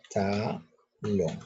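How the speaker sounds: TALÓN split into ta... lón; syllable by syllable. Shown as a demonstration. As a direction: dictate "talón" one syllable at a time. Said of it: "Allí cuentas tres sílabas". ("talón" separado en sílabas, ta... lón)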